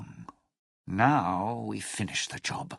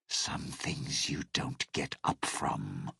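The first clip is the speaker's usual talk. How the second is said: whispered; 6 semitones lower, 6 dB quieter than usual. Acted. Bleak aside, voice dropping.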